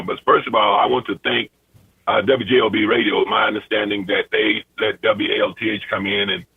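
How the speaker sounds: ordinary delivery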